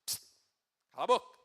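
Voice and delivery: sudden high, loud shut-up with a sharp shushing; blunt silencing command. Acted. God telling Jeremias to stop talking, loud silencing command. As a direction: cut them off loudly and abruptly. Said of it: "Na verdade, Deus tava falando aqui".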